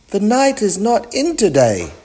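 high-pitched put-on lady voice; pretend lady. A narrator's reading.

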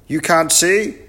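loud, very high professor voice; muddled professor. Acted. Professor mishears the greeting, answers loud and high. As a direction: boom it out high, quite sure of yourself.

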